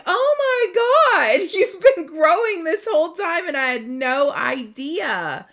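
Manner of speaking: laughing disbelief at the hidden growth; amazed discovery. Retold or imitated. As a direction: gasp out Oh my God at the vine's growth. Retold shock discovering grown vine.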